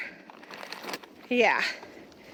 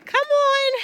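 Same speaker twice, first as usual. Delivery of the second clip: very high, louder call; calling the cows; 12 semitones higher, 4 dB louder than usual. Real, not acted. Farmer calling her cows to the gate, very high call.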